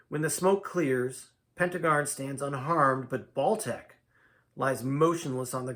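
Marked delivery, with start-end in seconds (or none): none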